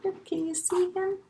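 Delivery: ordinary delivery, nothing marked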